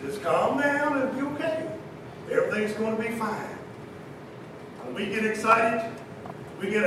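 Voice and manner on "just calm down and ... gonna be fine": soft, soothing words; calming reassurance. Acted. Jesus to overexcited followers who can hardly breathe, calming reassurance. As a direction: settle them down gently and quietly.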